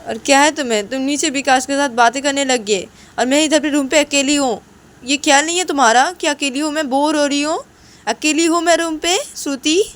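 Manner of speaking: high, loud tirade of complaint; angry yelling. Acted. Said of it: "इतना चिल्लाओ ना मत". (गुस्से में चिल्लाकर शिकायत)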